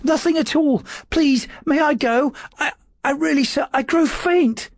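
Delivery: high, thin frightened clerk's voice, slowing toward the end; a terrified little man begging to leave; gasping, faint secretary. A narrator's reading. Frightened secretary Dawes begging his lord to go, high and faint. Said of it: "he gasped".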